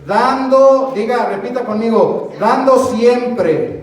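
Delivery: slow, loud, drawn-out lines for the church to repeat; call and response. Acted. Pastor leading the congregation to repeat the verse, loud and slow. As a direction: lead the congregation word by word.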